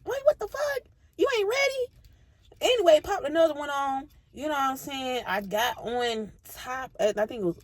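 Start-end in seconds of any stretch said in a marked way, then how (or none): 0.0-1.9 very high squeaky outrage; incredulous. Retold or imitated. Narrator mocking the guy's unreadiness, incredulous. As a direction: shriek in disbelief.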